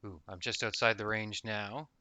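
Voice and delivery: ordinary talk, nothing marked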